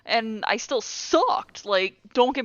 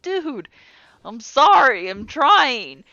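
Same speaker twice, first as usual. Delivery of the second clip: pleading; 3 semitones higher, 8 dB louder, slower than usual. Retold or imitated. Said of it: "I'm like".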